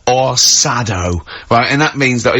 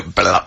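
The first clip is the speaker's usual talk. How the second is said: deep, lower croaked blah; low frog croak; 6 semitones lower than usual. Pretend. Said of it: "you go".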